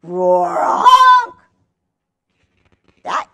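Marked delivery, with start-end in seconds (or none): 0.0-1.4 loud, high goose-lion roar honk; silly goose trying to roar. Shown as a demonstration. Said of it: "Can you do that?".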